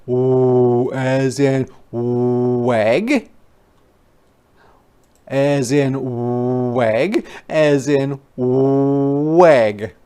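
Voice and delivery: ordinary talk, nothing marked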